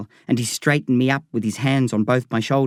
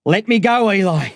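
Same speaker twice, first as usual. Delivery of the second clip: raised, higher shout; 7 semitones higher, 4 dB louder than usual. Acted. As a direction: push the words out to break free. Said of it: "Then he shouted at me".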